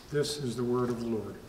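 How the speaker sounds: normal talking voice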